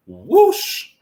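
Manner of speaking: quick rising whoosh noise; high swooping "whoosh"; imitated whoosh. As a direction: make a whoosh of time flying past. Imitating how fast year went.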